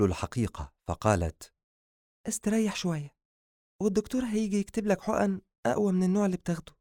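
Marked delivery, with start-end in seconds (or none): 2.3-6.7 high-pitched, brisk reassurance; quick practical care for a patient; caring doctor heroine. Acted. Habiba tells Adham to rest until the doctor comes, caring.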